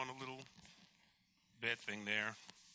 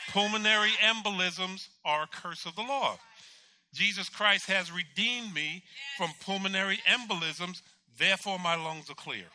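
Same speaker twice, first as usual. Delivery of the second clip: loud, raised preaching proclamation; forceful declaration; 4 semitones higher, 5 dB louder than usual. Retold or imitated. God giving the patient words to confess, forceful declaration. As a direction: declare it with full conviction.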